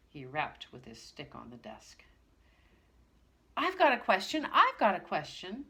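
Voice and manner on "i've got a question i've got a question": loud, high, eager call; called out eagerly. Acted. Pixie calls out eagerly to the teacher. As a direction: shout it out to grab attention. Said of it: "suddenly called out Pixie".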